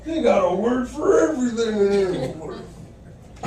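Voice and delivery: loud, high fake astonishment; mock amazed shout. Shown as a demonstration. Comic shouting amazement to scare off sales staff, loud high mock awe. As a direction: yell it like a dazzled yokel. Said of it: "so the sales staff leave me".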